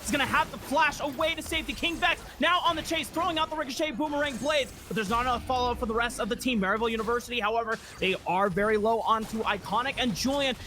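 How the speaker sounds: fast, high, excited esports commentary; live play-by-play. Real, not acted. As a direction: call the fight at full speed.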